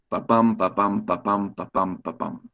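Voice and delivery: heartbeat imitation. Shown as a demonstration. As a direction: tap out a heartbeat with your voice until it dies away. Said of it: "It's the sound of the heartbeat".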